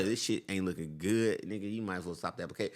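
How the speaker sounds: lightly voiced street banker, straight-talking. Banker bluntly telling a customer to give up, lightly voiced, low. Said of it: "the first way was the most honest way".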